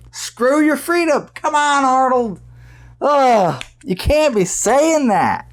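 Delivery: high-pitched, incredulous protest; exasperated disbelief. Real, not acted. Fan scolding a childhood hero over a remark, high-pitched and exasperated. Reacting in disbelief to Arnold's quote.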